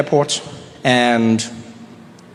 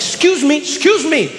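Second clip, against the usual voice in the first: excited show-off; 8 semitones higher than usual. Retold or imitated. Comedian at the carousel urging his bag out, excited high shout. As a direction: push through the crowd, rising to a shout. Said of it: "I was like".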